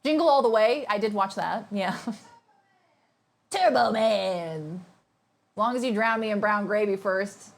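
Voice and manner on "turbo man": drawn-out, laughing movie quote; slow laughing Turbo Man. Retold or imitated. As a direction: savour the name with a laugh. Quoting Jingle All the Way.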